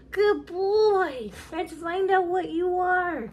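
high loud slow pet praise; dog praise voice. Real, not acted.

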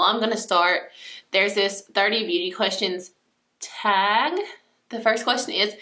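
ordinary talk

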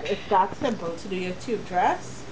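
ordinary talk

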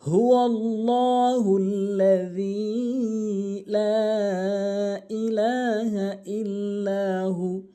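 slow, sustained chanting with long-held vowels; Quran recitation. Shown as a demonstration. Teacher opens the Quran lesson with a verse, slow melodic recitation. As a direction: recite it slowly and melodically, stretching each elongation.

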